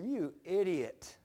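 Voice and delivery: slow, drawn-out scold; slowly chiding himself; self-scolding. Retold or imitated. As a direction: stretch out the insult to yourself. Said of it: "I go, I said".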